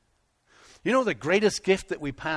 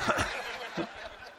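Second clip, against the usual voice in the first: laughing; 9 semitones higher, 5 dB quieter than usual. Real, not acted. Pastor laughs at own joke.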